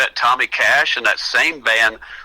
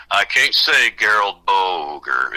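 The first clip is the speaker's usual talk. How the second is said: imitating a laboured try at a name; slow, low, drawn-out struggle with the name; mock-enunciate it slowly and low; 4 semitones lower, slower than usual. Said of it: "he would try to enunciate my last name".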